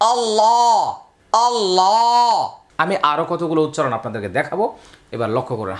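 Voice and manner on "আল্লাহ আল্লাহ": "আল্লাহ" with a heavy, full-mouthed lam, held long and higher. Shown as a demonstration. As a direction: say "আল্লাহ" with a thick dark lam, drawn out. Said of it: "উচ্চারণ হবে".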